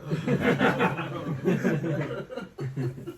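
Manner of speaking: long, soft trailing laugh. Real, not acted. Speaker amused at his own tenant remark, laughing. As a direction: let a quiet laugh run on.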